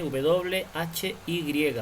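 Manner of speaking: W H Y spelled letter by letter, slowly. Shown as a demonstration. Teacher spells the word for the class letter by letter. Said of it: "spelling out letters".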